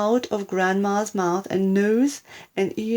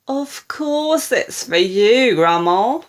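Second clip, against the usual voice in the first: slow, loud, sugary reassurance; oily, smiling encouragement, drawn out; sweetly; 2 semitones higher, 6 dB louder than usual. A narrator's reading. Man coaxing grandma to take it, slow sweet smile. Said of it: "he smiled sweetly".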